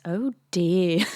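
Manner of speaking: usual speaking voice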